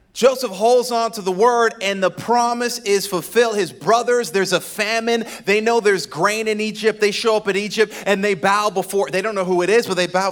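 loud, high preacher's build; preaching at full force. Acted. Pastor preaching to the congregation, full force. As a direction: preach it out to the church.

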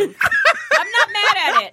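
slow, laughing drawl. Real, not acted. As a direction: laugh through it. Laughing, repeated.

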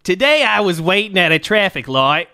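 fan letter in a light Aussie voice; mock Australian accent. Reading an Australian fan's letter, a mock accent. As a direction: read it like a broad Australian. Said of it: "I'm gonna stop doing that".

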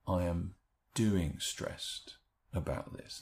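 slow, weight on doing; stress on doing. Shown as a demonstration. Teacher modelling the reframe, slow and stressed. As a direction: draw out "doing stressed about this" with doing stressed. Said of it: "encourage people to move from".

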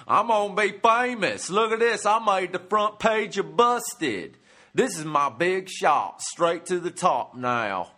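high, loud, dopey hillbilly boasting. Retold or imitated. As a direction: gloat like a proud dimwit in a mugshot. Mugshot people bragging, mocked.

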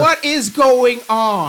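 slow, loud bewilderment; bewildered. Acted. Player baffled by sudden French, loud and slow. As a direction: cry out in confusion.

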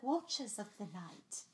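slow, soft, mock-dramatic British; a bad British accent, hushed and grand; hammed-up British accent; crappy British accent. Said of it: "I do it in the most crappy British accent".

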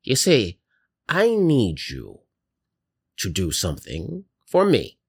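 put-on slow, swooping old-woman voice; old lady grandma. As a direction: confide in your chosen ones like a grandma. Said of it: "in an old lady's".

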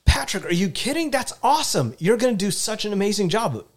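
high, delighted congratulations; excited cheering. Shown as a demonstration. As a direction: gush over his news like a fan. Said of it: "if I'm like".

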